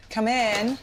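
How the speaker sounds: called out loud and high toward the door; calling out. Real, not acted. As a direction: call the patient in through the door.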